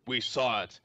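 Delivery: ordinary delivery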